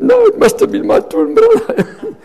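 very high, loud, through laughter; laughing protest. Retold or imitated. Twin explaining the mix-up to the convert, laughing protest. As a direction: protest while breaking into laughs. Comic reveal of mistaken identity.

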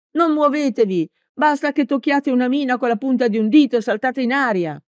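high, urgent call; urgent warning shout. A narrator's reading. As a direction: shout the warning to stop him. Smilzo warns of mines.

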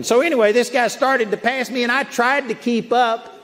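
ordinary talk, nothing marked

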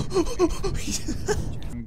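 high-pitched, wheezy burst of laughter; helpless laughter. Real, not acted. Bursts out laughing mid-fight.

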